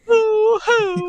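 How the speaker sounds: high, loud boo-hooing; hammed-up squeaky sobs; mock crying. Offended character laments to the hosts, mock crying. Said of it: "I'm crying".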